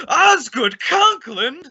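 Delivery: slow, high and loud indignant cry; shocked, affronted exclaim; outraged. Acted. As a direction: spit the name out in slow outrage. Outraged at the forged name.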